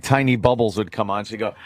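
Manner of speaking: ordinary talk, nothing marked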